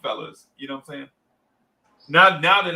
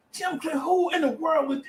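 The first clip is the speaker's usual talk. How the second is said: high, incredulous retelling; imitated high-pitched bewilderment; mock disbelief; 11 semitones higher than usual. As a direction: act out disbelief in a much higher voice. Child overhearing trade rumor, disbelieving.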